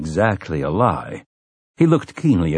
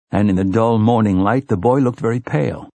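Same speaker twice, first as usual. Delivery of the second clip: ordinary delivery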